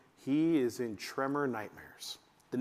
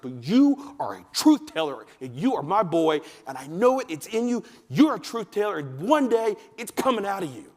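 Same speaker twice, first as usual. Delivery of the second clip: louder, emphatic declaration; loud insistence; 4 semitones higher, 6 dB louder than usual. Retold or imitated. Mom declaring to her son he is a truth teller, loud and emphatic. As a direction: pound the words in so he believes them.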